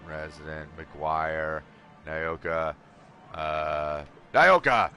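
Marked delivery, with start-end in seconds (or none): 4.3-4.9 loud, high recognition; excited. Real, not acted. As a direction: call the name out gleefully. Recognizing the name.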